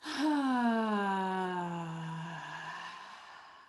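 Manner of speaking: a long, loud exhaling sigh. Shown as a demonstration. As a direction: let a big breath out as a sigh. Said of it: "And one more just like that".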